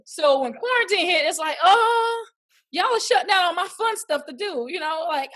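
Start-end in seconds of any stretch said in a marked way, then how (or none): 1.6-4.7 high, loud wail of disappointment at the start; mock dismayed complaint. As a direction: protest like your fun just got cancelled. Acting out dismay when quarantine hit.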